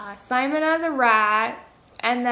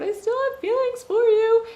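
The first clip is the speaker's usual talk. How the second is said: mock lovesick confession; 11 semitones higher than usual. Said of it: "where they're still like".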